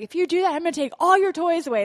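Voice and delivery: loud, high mock threat to a child; hammed-up parent threat. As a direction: yell the threat like an exasperated mom. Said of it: "I'm like".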